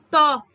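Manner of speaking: "Tó" called out loud alone. Shown as a demonstration. Teacher isolating stressed syllable loudly. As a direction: punch out "Tó". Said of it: "Qual que é a tônica?".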